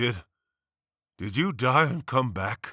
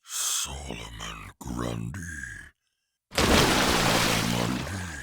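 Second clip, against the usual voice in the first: very low, slow monster growl; lumbering monster; 12 semitones lower, much slower than usual. Acted. Monster reciting his own nursery rhyme, low lumbering growl. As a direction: drag the rhyme out deep and heavy.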